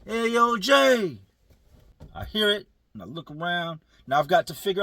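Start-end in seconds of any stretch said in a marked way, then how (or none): 0.1-1.2 higher, louder call-out; imitated yell. Prison friend hailing him across the yard, calling out. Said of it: "So he yells my name".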